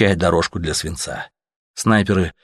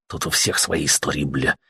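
growl; 5 semitones lower, 5 dB quieter than usual. A narrator's reading. Soldier growling at Artyom. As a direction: lower and softer, a rough growl. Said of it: "рыкнул Летяга".

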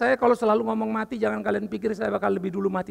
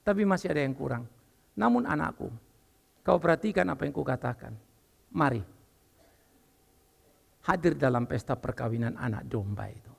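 God's gentle verdict; 6 semitones lower than usual. Pretend. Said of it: "aku yakin dia akan bilang".

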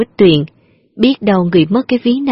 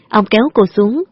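ordinary delivery, nothing marked

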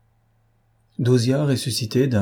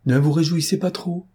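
high-pitched, playful warning; lightly acted menace; mock threat; 7 semitones higher than usual. A narrator's reading. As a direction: warn them off in a lifted voice. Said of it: "d'un air menaçant".

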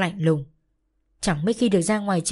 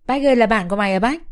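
pouting tease; 3 semitones higher, 5 dB louder, faster than usual. Acted. Girl teasing her friend about the stranger, mocking and quick. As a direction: needle him with a rising ơi. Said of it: "Cái Hiền trù cái miệng ra".

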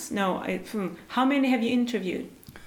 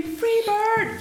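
mock scream; 11 semitones higher than usual. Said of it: "I wanna scream".

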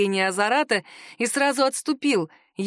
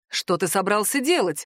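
ordinary delivery

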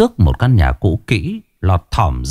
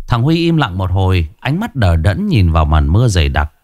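plain reading voice

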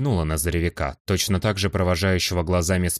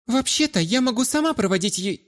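put-on very high, insistent girlish objection; interfering girl. Brunette butting in to escort her friend, insistent. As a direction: cut in high-pitched and break off mid-word.

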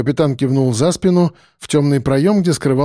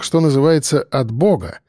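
usual speaking voice

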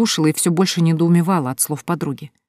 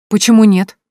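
higher, louder defiant challenge; 5 semitones higher, 10 dB louder than usual. A narrator's reading. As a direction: throw down the challenge. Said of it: "с вызовом спросила Соня".

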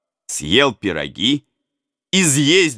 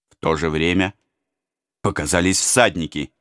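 ordinary delivery

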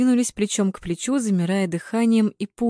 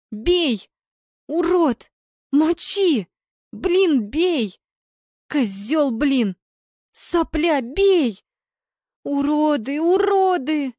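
high, heated cries at the players; frantic fan yelling; 8 semitones higher, 3 dB louder, much slower than usual. A narrator's reading. Girls urging then cursing their players, frantic yelling. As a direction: yell and curse in high excitement.

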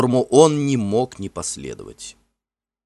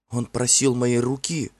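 plain reading voice

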